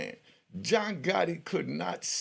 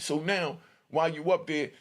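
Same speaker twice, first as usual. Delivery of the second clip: ordinary talk, nothing marked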